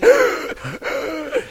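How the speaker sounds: high startled gasp; sharp gasp. Retold or imitated. Chasing the escaped chicken, a high startled gasp. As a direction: gasp in sudden alarm.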